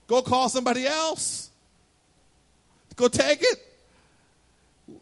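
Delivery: hammed-up fed-up savior; mock exasperated Jesus. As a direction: brush her off like you're too busy. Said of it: "if I was Jesus, I would have said".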